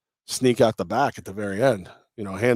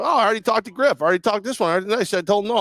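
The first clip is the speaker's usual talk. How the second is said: frantic; 9 semitones higher, much faster than usual. Retold or imitated. Retold frantic calls to replacements.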